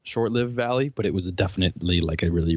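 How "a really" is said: normal talking voice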